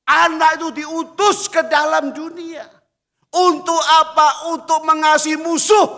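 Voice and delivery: loud, high pulpit cry; loud, high preaching; full-force preaching. Acted. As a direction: call it out to the whole church.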